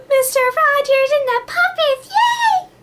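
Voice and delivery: very high, half-crying cheer; teary kid joy. Retold or imitated. Imitating her child self crying happy at Mister Rogers. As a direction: gush like a teary little kid. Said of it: "I would cry".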